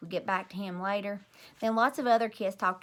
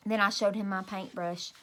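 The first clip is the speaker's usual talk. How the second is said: ordinary delivery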